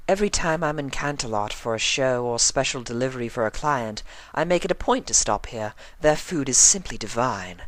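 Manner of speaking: high, refined, slightly hushed; Rarity's voice. A narrator's reading. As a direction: assures Spike about the food.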